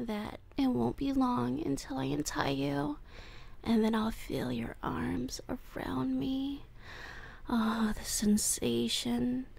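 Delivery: creepy lovesick voice, drifting into breathy whispers; obsessive captor. Acted. Captor confesses love to tied-up Wolfie, creepy and hushed. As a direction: confess your obsession to your captive, unhinged.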